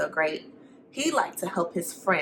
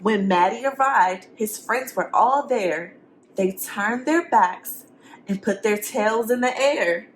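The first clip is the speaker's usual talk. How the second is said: lively, sing-song storybook reading; reading to children; 3 semitones higher than usual. A narrator's reading. Teacher reading a picture book to the class. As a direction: read it to the kids with rhyme.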